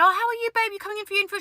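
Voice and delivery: high, sing-song client greeting; sweet babying greeting. Retold or imitated. Lash lady asking a client about her infill, sweetly babying.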